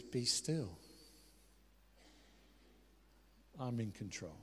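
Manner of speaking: low, soft, measured command; calm reassurance. Acted. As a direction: soothe with quiet authority and pauses. Voicing Jesus calming the storm.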